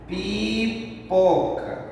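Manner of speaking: pipoca stretched wide, long piii, then poca; slow syllable demo. Shown as a demonstration. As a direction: draw out and separate pipoca very slowly. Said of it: "Então você fala devagar". (pipoca bem devagar, esticando as sílabas)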